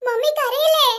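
moaning through contractions; labour pain. Acted. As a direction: wail as if the pain is unbearable. Labour pains, moaning and wailing. (വേദന സഹിക്കാതെയുള്ള കരച്ചിൽ)

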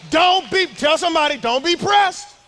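high, loud shout of the punchline; preacher's climax shout. Real, not acted. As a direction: yell it out to the crowd.

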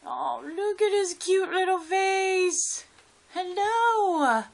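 high, loud, slow gushing coo. Real, not acted. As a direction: melt over the cute little face. Whispered then gushed.

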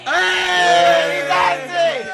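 loud, high delighted shout of Hey; excited cheer. Real, not acted. Host cheering his co-host's pun with a loud high whoop. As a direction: whoop at a friend's good joke.

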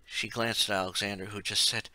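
usual speaking voice

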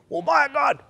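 high, loud mock curse; preacher mocking a heathen's swear. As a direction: sneer it like a fool cursing. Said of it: "Some fool heathen going".

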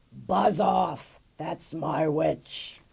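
loud slow growling beast; monster voice. A narrator's reading. Horrible beast warning the dragon off, slow growl. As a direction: snarl it like a swamp monster. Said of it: "its terrible voice ... a yowl and a growl".

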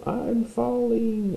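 high yelled cry for help as he falls; falling man's cry. Pretend. Cartoon man falling off cliff cries for help. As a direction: shout it high, as if dropping off a cliff. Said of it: "He's saying".

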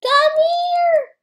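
high crying call; high pleading yell. Real, not acted. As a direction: call out desperately, voice breaking. Desperate call in game.

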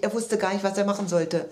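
ordinary delivery

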